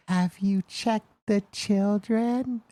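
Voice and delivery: put-on high, slow eerie little kid voice; creepy child voice. Father imitates his son quoting a horror movie, high eerie voice. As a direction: imitate a three-year-old's eerie line, high and slow. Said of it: "in his high-pitched little voice".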